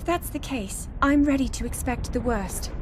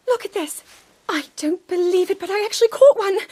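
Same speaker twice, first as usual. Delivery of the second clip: higher and much louder with delight; bursting with excitement over a find; thrilled discovery; 5 semitones higher, 6 dB louder than usual. Acted. Researcher showing the hero her rare catch, thrilled.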